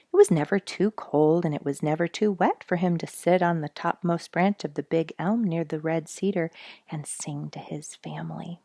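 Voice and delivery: ordinary delivery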